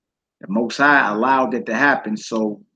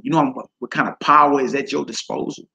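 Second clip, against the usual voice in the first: normal talking voice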